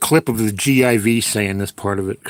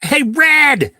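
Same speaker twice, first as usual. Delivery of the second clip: calling out; 14 semitones higher, 7 dB louder than usual. Real, not acted. Calling out to someone.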